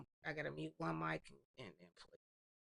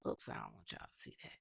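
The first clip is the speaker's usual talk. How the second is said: usual speaking voice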